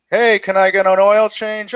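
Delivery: customer voice. Retold or imitated. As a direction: play a casual caller asking for service. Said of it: "I mean, you know".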